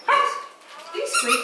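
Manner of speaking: very high squeak-like voice; tiny squeaky voice for a puppy. Real, not acted. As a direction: squeak the question like the toy. Asking puppy about squeaky toy.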